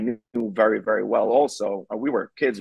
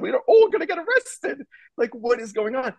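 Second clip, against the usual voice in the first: exasperated; 13 semitones higher than usual. Retold or imitated. Exasperated disbelief.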